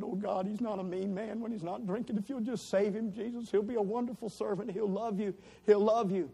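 high, imploring plea to God; pleading prayer. Retold or imitated. Wife begging God to save her husband. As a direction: beg God like a desperate wife.

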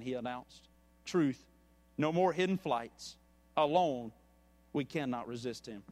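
measured storyteller reading a parable to the congregation; story narration. A narrator's reading. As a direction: tell the tale steadily, letting the quotes land. Reads a parable aloud.

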